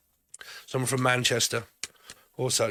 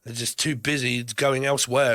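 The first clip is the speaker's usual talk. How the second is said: ordinary talk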